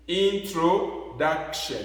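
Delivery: INTRODUCTION slowly, stress on "duc"; next-to-last syllable stressed. Shown as a demonstration. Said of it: "l'accent sur l'avant-dernière syllabe".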